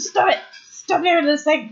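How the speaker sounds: high mock scold; imitating Paige. Paige scolding the fighters, high mock voice. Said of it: "She's like".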